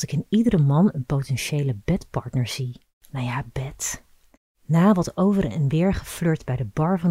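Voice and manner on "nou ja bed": whispered aside. A narrator's reading. Sly aside about seeing bedpartners.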